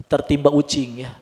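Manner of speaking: ordinary talk, nothing marked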